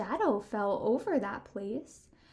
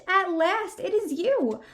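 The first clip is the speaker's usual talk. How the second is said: excited; 6 semitones higher, 5 dB louder than usual. Acted. George thrilled, fast and higher.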